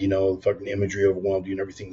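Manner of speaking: rushed, offhand skeptic; fast dismissive rattle. Retold or imitated. A skeptic dismissing the imagery, rushed. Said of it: "would step up and go".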